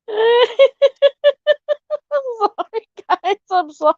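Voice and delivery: word broken up by a laugh; giggling it out. Real, not acted. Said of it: "I can hear every fucking word".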